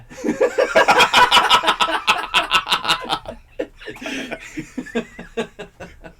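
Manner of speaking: long airy high laugh; real helpless laughter. Real, not acted. Host cracking up at his own story, long laugh.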